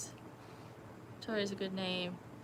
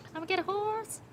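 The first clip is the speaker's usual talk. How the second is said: put-on much higher, childlike voice; little kid voice; 12 semitones higher than usual. Streamer imitating a little kid wanting a horse, high childlike. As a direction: play the excited little girl. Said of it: "The same voice".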